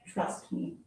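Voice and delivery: soft secretive whisper; hushed whispered reassurance. Acted. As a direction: breathe it to the listener. Narrator confides softly.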